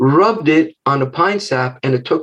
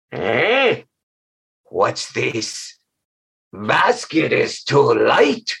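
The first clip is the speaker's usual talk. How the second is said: slow, low puzzled growl; suspicious monster; 3 semitones lower, much slower than usual. Acted. Monster noticing the light basket, slow suspicious voice. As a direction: sound like a giantess sensing a trick. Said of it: "Tanakwa stopped".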